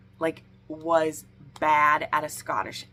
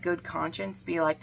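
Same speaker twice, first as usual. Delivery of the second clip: ordinary talk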